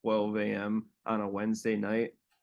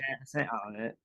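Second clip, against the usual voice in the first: Australian accent placed back in the throat; softer, throaty mock Australian; 4 dB quieter, faster than usual. Shown as a demonstration. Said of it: "more like back of your throat".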